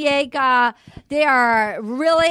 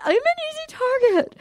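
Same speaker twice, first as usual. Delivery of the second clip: high-pitched mock whine; hammed-up high victim voice; Nicole; 11 semitones higher, faster than usual. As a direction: plead it way up high, put-on. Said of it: "Nicole's like".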